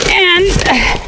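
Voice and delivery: straining while reaching for a zipper; effort grunt. Real, not acted. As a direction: effort grunt mid-sentence. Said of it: "excuse me for the grunting, but I'm trying to reach the zipper".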